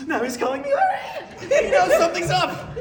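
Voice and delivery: high, loud laughing outburst; cracking up mid-telling; laughing shout. Real, not acted. Comic telling a crowd about a prank call, laughing loudly. Laughing through the story climax.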